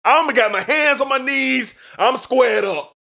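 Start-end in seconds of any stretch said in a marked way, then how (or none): none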